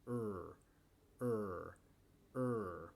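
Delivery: low, held "er" with the tongue off the palate; sonorous English R. Shown as a demonstration. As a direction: sound out "er" slowly as a resonant R. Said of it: "Intenta hacer ese sonido".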